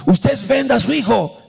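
imitating an annoyed heckler, pitched high; high indignant yell, without real volume; mock angry shout. Congregant calling back at the pastor's question, mock angry shout. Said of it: "me gritó un hermano".

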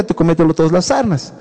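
ordinary talk, nothing marked

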